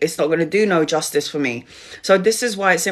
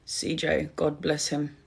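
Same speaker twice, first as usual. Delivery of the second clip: barely audible, softer, grief catching the words; grieving hush; 3 semitones lower, 10 dB quieter than usual. Real, not acted. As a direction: say his name almost under your breath in grief. Mourning a murdered fourteen-year-old.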